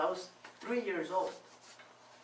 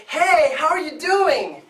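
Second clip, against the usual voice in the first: much higher and much louder, slow and sing-song; a cheerful mother calling her son; bright mom greeting; 9 semitones higher, 12 dB louder, slower than usual. Retold or imitated.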